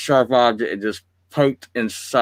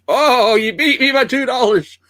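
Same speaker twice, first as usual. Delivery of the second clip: surprised; 13 semitones higher, 4 dB louder than usual. Real, not acted. Outbid on a toy price.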